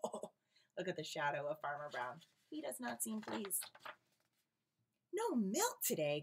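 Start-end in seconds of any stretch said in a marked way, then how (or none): 5.1-6.2 louder, higher and slow dismay; upset cry. A narrator's reading. Farmer Brown upset at his cows, crying out louder. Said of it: "cried the Farmer Brown".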